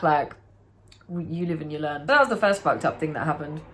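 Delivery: ordinary talk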